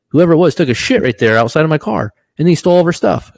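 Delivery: climbing disbelief at the absurdity; incredulous. Real, not acted. Friend's crackhead story punchline.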